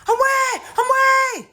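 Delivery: high, loud, drawn-out acted scream; screaming for help. Aunt crying for help as she's robbed, screaming. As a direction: scream each cry on one sustained high pitch. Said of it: "She's still screaming".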